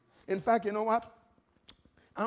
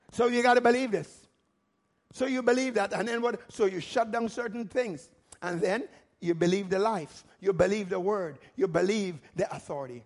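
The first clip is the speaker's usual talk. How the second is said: normal talking voice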